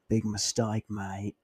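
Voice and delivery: low-key British tough guy, soft; British ninja one-liner, quietly delivered; mock British accent. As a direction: softly threaten with a put-on British accent. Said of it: "He's not even British".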